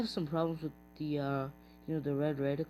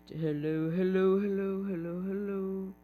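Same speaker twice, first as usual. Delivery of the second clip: louder, higher repeated hellos; calling out; 3 semitones higher, 4 dB louder than usual. Real, not acted. Gamer calls out to players joining the lobby. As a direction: call out to get their attention.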